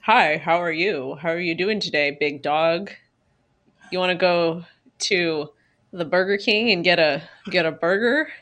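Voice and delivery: low, slow, lightly voiced goofy guy; a hint of a stiff pickup line; mock awkward suitor. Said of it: "somebody was like".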